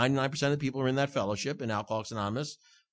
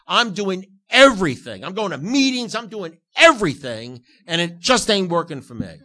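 loud complaint; 4 semitones higher, 7 dB louder than usual. Retold or imitated. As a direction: complain loudly to the sponsor that nothing works. Retells himself whining to his sponsor.

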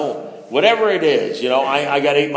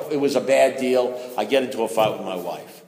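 usual speaking voice

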